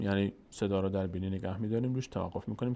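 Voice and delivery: ordinary talk, nothing marked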